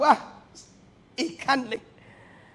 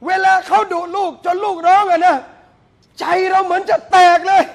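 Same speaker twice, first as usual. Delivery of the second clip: loud, raised preaching voice, almost shouting; the preacher rouses the congregation, loud and fervent; fiery preaching; 5 semitones higher, 7 dB louder than usual. Acted. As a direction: preach it to the crowd at full force. Preaching builds up again.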